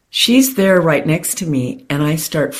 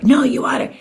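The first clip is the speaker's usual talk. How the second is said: insisting; 8 semitones higher, faster than usual. Retold or imitated. Repeating her insistence to date.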